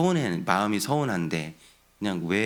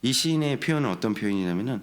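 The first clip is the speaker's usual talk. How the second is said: ordinary talk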